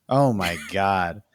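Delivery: normal talking voice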